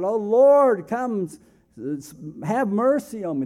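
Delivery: high, loud desperate plea for mercy; blind men crying out. Retold or imitated. Preacher voicing blind men begging the Lord: loud anguished cry. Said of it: "We have blind men crying out".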